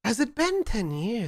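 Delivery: high, hammed-up German accent; Gustav. Sire questions Max, high theatrical German accent. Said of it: "in a horrible German accent".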